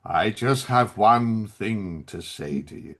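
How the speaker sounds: low, slow, heavy with gravitas; grave villain line. Retold or imitated. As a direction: intone it like a solemn movie villain. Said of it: "he goes".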